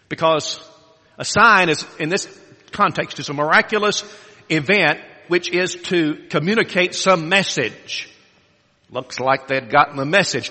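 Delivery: ordinary talk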